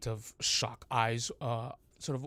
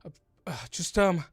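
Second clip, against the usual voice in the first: higher, louder, hurried orders; Dominic urgent; 8 semitones higher, 8 dB louder, much faster than usual. Acted. Dominic orders the guard to carry the wounded man, higher and hurried. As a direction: rush out the orders to get him moving. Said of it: "Dominic just goes".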